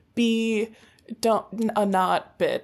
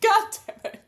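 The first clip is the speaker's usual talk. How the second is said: high voice, fighting back laughter; squeezed out through suppressed giggles; trying not to laugh; 13 semitones higher, faster than usual. Real, not acted. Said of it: "she's holding back laughter".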